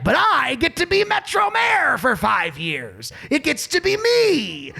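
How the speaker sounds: high, slow, hammed-up politician; Steve Stenger. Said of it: "That's my Steve Stenger impression".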